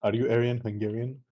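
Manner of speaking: usual speaking voice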